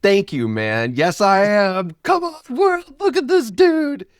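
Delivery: hammed-up proud shout to the world; proud exclamation. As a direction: crow it to the whole world. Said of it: "Now I'd be like".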